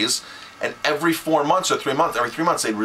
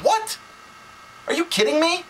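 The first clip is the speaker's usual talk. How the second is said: shocked, much higher squeak of disbelief; high incredulous what, then are you kidding me; retold disbelief. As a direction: replay the moment you couldn't believe it. Retold phone call with rep.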